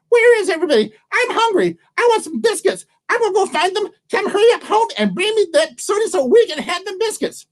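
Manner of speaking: very high, squeaky squirrel voice; high-pitched animal character voice; hungry squirrel. Acted. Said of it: "Which the squirrel talk means".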